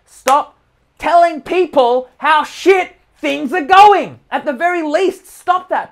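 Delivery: slow, forceful plea to stop; emphatic command. Real, not acted. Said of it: "urgent instruction, rising louder".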